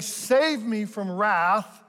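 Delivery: loud, drawn-out cry for rescue; slow, emphatic mock plea; acted plea. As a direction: call out slowly and loudly for help. Voicing grandson's cry for help.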